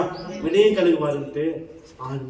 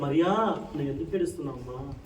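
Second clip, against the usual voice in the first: soft, lowered, tender voice; gentle questioning; 6 dB quieter than usual. Acted. Jesus asking weeping Mary why she cries, gentle and soft. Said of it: "మధ్యలో మరియాని చూసి అడిగాడు".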